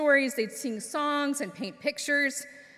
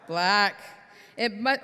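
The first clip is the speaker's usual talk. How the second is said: ordinary delivery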